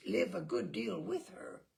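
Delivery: usual speaking voice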